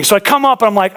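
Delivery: usual speaking voice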